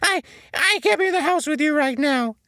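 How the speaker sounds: very high, loud cartoon sponge, hurt and accusing; high, loud, indignant sponge character; SpongeBob voice, upset. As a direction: squeal it out like a wounded SpongeBob. SpongeBob voiced through argument.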